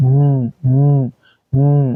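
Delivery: উম as a level hummed "nnn"; held N sound. Shown as a demonstration. Teacher drilling the N sound, উম held as "nnn". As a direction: repeat উম as separate held N hums. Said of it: "নয়ের মতো sound হবে".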